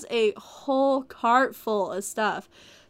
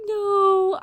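mock anguish; 9 semitones higher, 6 dB louder than usual. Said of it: "I was like".

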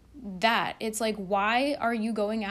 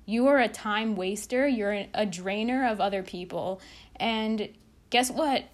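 ordinary talk, nothing marked

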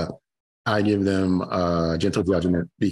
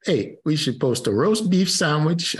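higher, slow, shaky-attempt Canadian accent; 8 semitones higher, slower than usual. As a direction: have a go at a Canadian accent, drawn out. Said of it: "I can't do, what's a Canadian accent?".

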